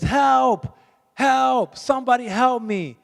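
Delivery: high, loud cry for help; acted shout from the doorway; yelling for help. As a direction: call out for somebody to come, long falling "help". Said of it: "He's yelling".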